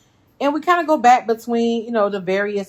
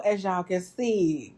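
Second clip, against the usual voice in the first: ordinary delivery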